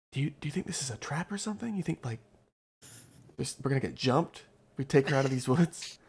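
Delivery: fast hushed whisper to a friend. Acted. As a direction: lean in and whisper it quickly. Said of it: "and whispers".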